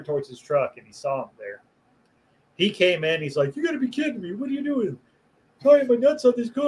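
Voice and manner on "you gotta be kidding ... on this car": high, outraged coworker voice, pitched up; mock outraged coworker. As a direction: play the kid finding nuts on his truck, voice jumped up high. Coworker's shocked reaction retold.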